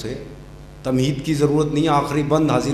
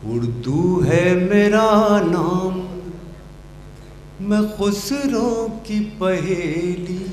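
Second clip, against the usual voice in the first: stately nazm recitation; 6 semitones higher, much slower than usual. Acted. Poet sings his nazm.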